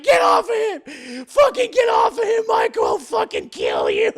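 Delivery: high, loud screamed order; hammed-up enraged wife at full volume; acted furious threat. Wife aims shotgun at husband, mock screaming threat.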